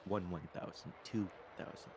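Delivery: soft, quiet breath counting. Pretend. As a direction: count the breaths gently like a coach. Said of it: "making me manually breathe".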